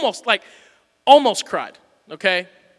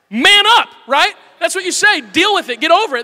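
loud, higher macho scolding; tough-guy bark; 8 semitones higher, 8 dB louder than usual. Pretend. Preacher shouting what men tell each other, mock macho. Mocking tough male advice.

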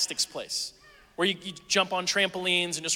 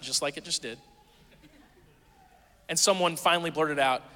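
ordinary talk, nothing marked

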